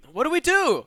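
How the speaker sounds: high, loud alarmed cry; a kidnapper losing his nerve; mock panic; panicked captor. Panicked captor in read story.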